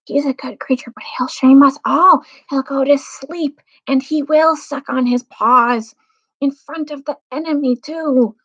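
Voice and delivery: dwarf grumbling aside. Acted. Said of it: "whispered Trumpkin to Peter".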